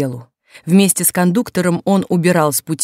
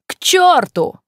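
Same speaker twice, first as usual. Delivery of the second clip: high, forceful narrated cry; defiant shout; 10 semitones higher, 4 dB louder, slower than usual. A narrator's reading. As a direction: fling a curse back at the policeman. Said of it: "крикнул другой бастующий". (резкий высокий выкрик)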